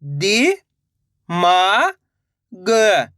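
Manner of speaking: "दि", "मा", "ग" separated by pauses; syllable by syllable. Shown as a demonstration. Teacher modelling for learners to repeat, syllable by syllable. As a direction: sound out "दि … मा … ग" one at a time. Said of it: "direct मात्रा का उच्चारण करके".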